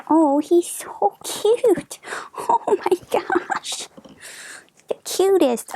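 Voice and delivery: high, delighted squeal at something adorable; gushing over cute. Real, not acted. Delighted by a cute dragon card.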